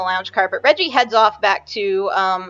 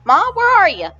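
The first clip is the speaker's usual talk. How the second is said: calling out; 9 semitones higher, 3 dB louder than usual. Acted. Calling out for her mother.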